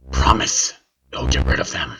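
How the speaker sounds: put-on deathbed whisper of a frightened boy; weary, desperate whisper. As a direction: whisper it like an old man on his deathbed. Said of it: "He sounded like an old man on his deathbed".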